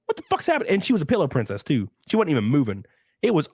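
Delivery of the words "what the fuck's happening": alarmed. Retold or imitated. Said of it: "And I'm like".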